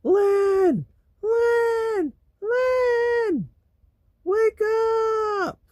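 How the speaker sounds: very high, loud reenacted screaming; retold scream. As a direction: scream as if waking someone sound asleep. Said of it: "screaming to the top of my lungs".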